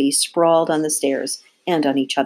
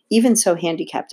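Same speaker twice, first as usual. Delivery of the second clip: ordinary delivery, nothing marked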